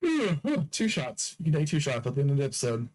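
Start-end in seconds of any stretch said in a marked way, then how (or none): 0.0-0.7 high-pitched annoyed groan. Real, not acted.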